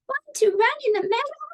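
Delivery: very high, quick sheep voice; bleating sheep. Acted. Sheep invites spider to the meadow, high bleat. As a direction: squeak it out like a little sheep. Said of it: "Bleated the sheep".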